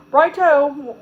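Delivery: hammed-up British chap; fake British accent. A narrator's reading. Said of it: "on a fake British accent".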